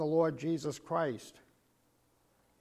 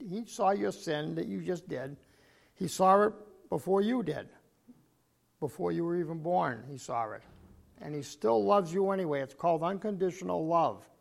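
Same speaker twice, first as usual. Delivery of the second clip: normal talking voice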